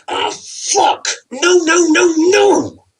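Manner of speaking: high, loud wail of frustration; anguished cry. Acted. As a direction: howl over the broken controller. Man breaks controller in rage.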